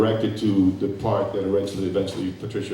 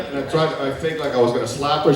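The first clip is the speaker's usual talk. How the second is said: usual speaking voice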